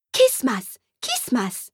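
put-on high babbling toddler chant; a tiny girl squealing the word; excited toddler. Toddler to Santa, high and chanting.

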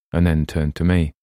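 usual speaking voice

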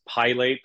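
"Pilate" as PIE-late; "Pilate" opening like the word pie; mispronounced Pilates. Shown as a demonstration. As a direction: say "Pilate" with a long I and long A. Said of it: "people pronounce it wrong a lot".

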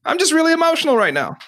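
high emotional admission. Retold or imitated. Voicing someone naming emotion.

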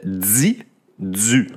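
"di, du" said as dzi, dzu with a buzzing z; Quebec di du. Shown as a demonstration. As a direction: buzz the z into "di" and "du". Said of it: "qui se prononcent comme avec un Z".

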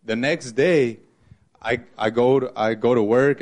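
normal talking voice

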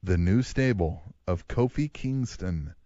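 slow dramatic announcer build. Acted. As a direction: announce it slowly like a wrestling show.

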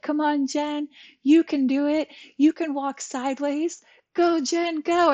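put-on high, loud cheer of a little girl; excited cheering. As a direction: cheer her on like an eager kid. Daughter cheering her on in therapy.

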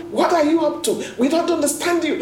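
angry outburst. Retold or imitated. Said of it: "blurted out and so mad, so angry".

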